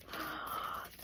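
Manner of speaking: soft, airy intake of breath; stunned gasp. Real, not acted. Reader stunned by the card she turned, soft gasp. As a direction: gasp quietly in real amazement.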